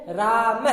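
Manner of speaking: "राम" drawn out, then a short mah ending; visarga pronunciation. Shown as a demonstration. Teacher reading the word with final visarga, pronounced demonstration. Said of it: "क्या पढ़ेंगे?".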